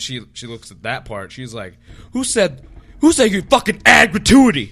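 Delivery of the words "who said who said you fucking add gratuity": mock furious shout, pitched high; outraged customer. Customer challenging the server over gratuity, outraged shouting. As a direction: yell it like an indignant customer.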